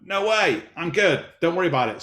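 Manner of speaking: mock-casual denial to his wife; breezy brush-off. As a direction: wave it away fast and light. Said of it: "And I'm like".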